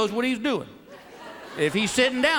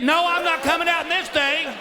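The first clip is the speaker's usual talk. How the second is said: higher mock wife voice; 6 semitones higher than usual. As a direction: pitch up into a whiny wife refusing. Wife refusing from dressing room.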